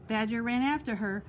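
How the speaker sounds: ordinary delivery, nothing marked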